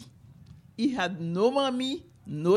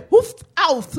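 American pain yelp; 5 semitones higher, 8 dB louder than usual. Shown as a demonstration. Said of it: "you're going to say".

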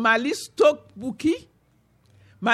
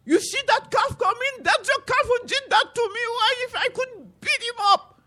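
much higher, indignant accusing voice; Buki's voice; 10 semitones higher than usual. Acted. Buki accusing the calf to Malice, high indignant voice. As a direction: point him out, outraged and high. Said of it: "Buki said".

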